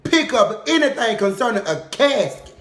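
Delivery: loud, high, outraged no; protesting auntie, high and loud; indignant refusal. Retold or imitated. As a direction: refuse the casket at full volume. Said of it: "she told me something".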